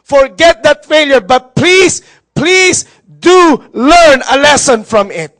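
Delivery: loud, high, emphatic sermon; fiery preaching. Real, not acted. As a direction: preach it hard to the congregation. Preaching at full force. (mariing pangangaral)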